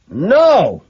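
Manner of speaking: sharp high shouted refusal; shouting. A narrator's reading. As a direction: cut in with a loud, high cry. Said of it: "I shout".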